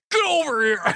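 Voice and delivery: mock ninja taunt; Scorpion. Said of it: "I'll do one more".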